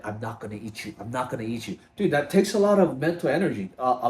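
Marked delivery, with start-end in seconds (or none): 0.0-1.8 low, soft self-talk, as if battling the urge; muttered inner struggle. Retold or imitated. Talking to the ice cream under his breath, quiet and strained. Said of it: "like, battle it".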